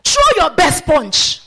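loud, high, slow dare to the devil; bold challenge. Acted. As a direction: hurl the challenge out like a lion. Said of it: "bold as a lion".

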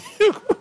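short high laugh. Real, not acted. Laughing at a pastor who won't work, short giggle. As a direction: let out a giggle.

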